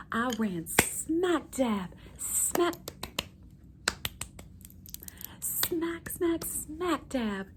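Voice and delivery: slow, stressed smack smack smack dab; emphatic smack. Acted. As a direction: hammer each smack slowly for comic effect. Acts out hitting the glass door.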